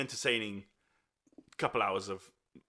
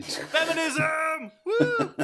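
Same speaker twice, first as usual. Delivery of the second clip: high, slow, loud mock cheer; sarcastic shout cheering right after the sexist remark; 6 dB louder, much slower than usual. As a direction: shout it out like a sarcastic rally cry.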